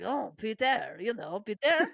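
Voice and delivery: put-on playful French accent on Peter; mock French accent. Host repeating the name Peter in a hammed-up French accent. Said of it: "I don't know why I made it French".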